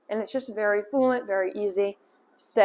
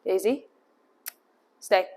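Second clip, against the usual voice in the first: ordinary delivery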